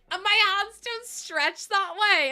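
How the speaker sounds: high, loud, laughing protest; cracking up while complaining; laughing complaint. Real, not acted. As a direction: wail it slowly through real laughter. Cracking up at her own hands.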